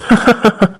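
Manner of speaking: short gloating villain laugh; a quick, pleased villain chuckle. Acted. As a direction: laugh softly at your own scheme. Villain gloating over his plan.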